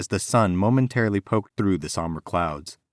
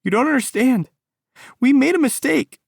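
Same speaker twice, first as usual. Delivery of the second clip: high, loud scream; 7 dB louder than usual. A narrator's reading. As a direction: scream at him, fists clenched. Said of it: "she screamed at him".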